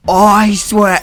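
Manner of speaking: loud, high, booming decree; first try at Hades' curse, loud and high; curse-casting god voice. Shown as a demonstration. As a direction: boom it out like a vengeful god. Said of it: "no, that is a terrible thing".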